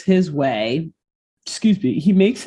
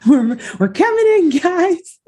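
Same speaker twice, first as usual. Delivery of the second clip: excited calling out; 11 semitones higher, 3 dB louder than usual. Pretend. Joking about luggage arriving.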